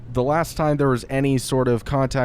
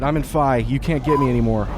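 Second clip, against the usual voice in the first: ordinary talk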